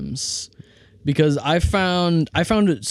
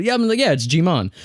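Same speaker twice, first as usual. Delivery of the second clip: confident; 5 semitones higher than usual. Retold or imitated. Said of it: "I said confidently".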